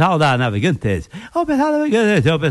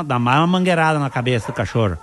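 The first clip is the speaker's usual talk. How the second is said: ordinary talk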